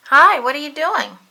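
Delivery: high, loud cheery hello; Ernie greeting. A narrator's reading. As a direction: call out brightly. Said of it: "asked Ernie".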